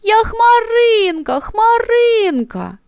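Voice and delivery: high, loud, tearful insisting; crying. A narrator's reading. The cloud, crying from hurt, insists to everyone who she is. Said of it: "від образи вона заплакала".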